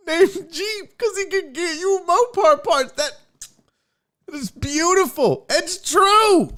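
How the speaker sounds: high, slow words squeezed through laughter; laugh-crying. Real, not acted. Host retelling the Jeep joke, crying with laughter. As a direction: barely get it out for laughing.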